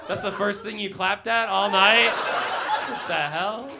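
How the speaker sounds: ordinary talk, nothing marked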